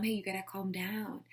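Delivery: soft, unruffled telling-off; calm way. Shown as a demonstration. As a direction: quietly ask them to settle down. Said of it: "talk to them in calm way".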